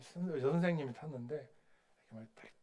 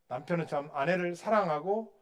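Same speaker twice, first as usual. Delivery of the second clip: usual speaking voice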